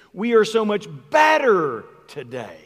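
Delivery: "better" punched high and loud, then falling away; stress on BETTER. Shown as a demonstration. Preacher demonstrating a shift of emphasis, stress on "better". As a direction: hit "better" hard, drop off on "today". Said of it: "we shift the emphasis on the sentence".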